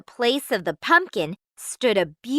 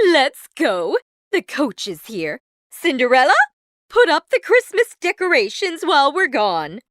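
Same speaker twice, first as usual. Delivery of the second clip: a lightly voiced bossy mother, high and loud; 4 semitones higher, 5 dB louder than usual. A narrator's reading. Mother ordering Cinderella before leaving, lightly voiced bossy. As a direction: hurry everyone out the door, lightly voiced.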